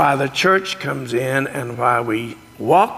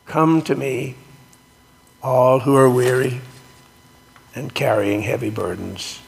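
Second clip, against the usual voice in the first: scripture reading. Acted. Reads scripture aloud in sermon.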